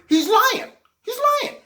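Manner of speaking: mock outrage. Ranting that the president lies.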